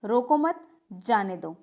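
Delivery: slow, रोको मत as one unit, then जाने दो; group मत with रोको, then a separate, falling जाने दो; pause after मत. Shown as a demonstration. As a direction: break after मत, letting जाने दो fall. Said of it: "और दूसरे में क्या है?".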